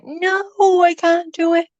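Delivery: high, whiny mock tantrum. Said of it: "I'm like".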